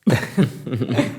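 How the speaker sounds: hushed little laugh; soft chuckle. Acted. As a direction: chuckle quietly to yourself. Chuckles at last night's mishap.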